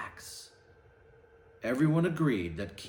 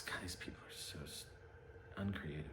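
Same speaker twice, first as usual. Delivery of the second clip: breathy fast whisper; whispered aside; 9 semitones lower, 20 dB quieter, much faster than usual. Real, not acted. Reader whispering a comment to the listeners, hushed aside. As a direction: mutter it under your breath. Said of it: "aside to audience".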